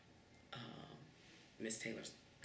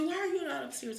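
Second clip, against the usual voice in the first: high, loud suspicious demand; accusing mother; 13 semitones higher, 6 dB louder than usual. Retold or imitated. Mother accusing the kid at the store, angry. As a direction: confront the kid sharply.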